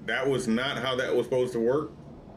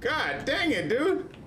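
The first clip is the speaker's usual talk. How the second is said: high-pitched frustrated whine; exasperated; 14 semitones higher, slower than usual. Real, not acted. Gamer cursing a failed attempt, exasperated.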